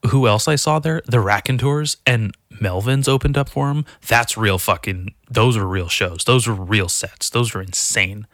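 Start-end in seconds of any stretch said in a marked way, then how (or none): none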